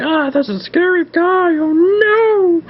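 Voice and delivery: very high, slow, frightened squirrel squeak; squeaky squirrel voice. Retold or imitated. Narrator voicing the trapped squirrel, high squeaky panic. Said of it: "That's what squirrels sound like".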